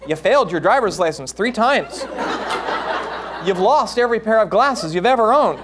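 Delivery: from laughing jab to high shouting panic; building hysterical mother. Acted. Mother listing son's failures, building to a shout. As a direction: ramp up from teasing to full melodrama.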